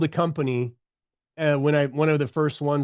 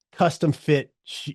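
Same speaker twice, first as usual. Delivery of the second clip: ordinary delivery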